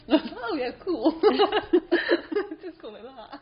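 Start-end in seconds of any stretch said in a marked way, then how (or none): 0.1-1.2 high, loud, laughing imitation; mock them through laughter; laughing mock reply. Mocking the trainers, high and loud with laughter. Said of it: "People were like". 1.3-2.6 loud high burst of laughter. Real, not acted. Laughing at her own mimicry of the trainers.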